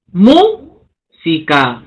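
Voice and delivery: música drawn out slowly, leaning on MÚ; slow stress demo. Shown as a demonstration. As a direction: stretch the word to show where stress falls. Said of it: "esdrújula".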